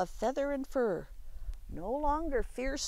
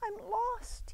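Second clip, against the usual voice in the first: put-on child crying out; 12 semitones higher, 4 dB quieter than usual. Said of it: "he cried".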